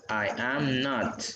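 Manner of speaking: "I am not" slowly, word by word; slow full answer. Shown as a demonstration. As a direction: lay out "I am not" slowly for students.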